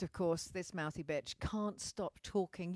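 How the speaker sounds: ordinary talk, nothing marked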